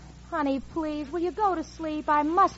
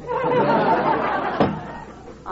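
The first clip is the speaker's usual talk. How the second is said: real laugh; 8 semitones higher, 5 dB louder than usual. Real, not acted. Laughs at the professor.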